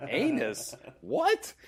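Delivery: retold disbelief, shooting up high on the question; mock bafflement. Guy hearing the botched word, mock bafflement.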